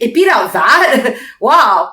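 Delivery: incredulous, emphatic amazement; amazed disbelief. Real, not acted. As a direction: marvel at it with a laugh. Reacting to film's box office success.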